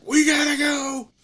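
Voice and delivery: high, loud urgent shout; the upset boss telling the narrator to get going. Retold or imitated. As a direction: yell it like you have to leave right now. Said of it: "And he's like".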